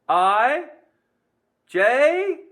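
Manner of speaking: high, loud rising letters; teasing trick question. Pretend. Teacher trying to fool students with wrong letters, high teasing question. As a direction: pitch each letter up like a question. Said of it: "I was just trying to fool you".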